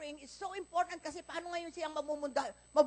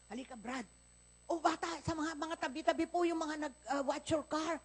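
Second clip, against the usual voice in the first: ordinary talk, nothing marked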